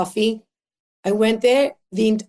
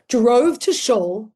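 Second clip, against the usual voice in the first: ordinary delivery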